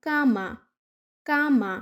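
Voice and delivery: কামা with a hard ক sound at the start, modelled for learners; Spanish word cama. Shown as a demonstration. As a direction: say কামা clearly, c as k. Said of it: "আরও একটা শব্দ যেমন".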